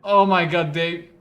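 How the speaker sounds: loud, aghast exclamation; shocked outburst. Real, not acted. Shocked discovery in game.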